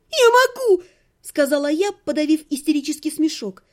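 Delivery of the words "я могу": high, loud and indignant; girl yells back at the necromancer; furious shout. A narrator's reading. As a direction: throw the endearment back at him. Hysterical insistence.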